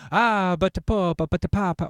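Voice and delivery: hammed-up Italian priest, high and loud. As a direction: priest pleading with the Pope in a mock Italian accent. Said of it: "Why does Father O'Malley sound".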